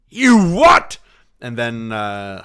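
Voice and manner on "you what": high, loud incredulous yelp; mock outraged squawk; shocked Craven. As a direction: shriek it in disbelief. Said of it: "Doctor Craven would be like".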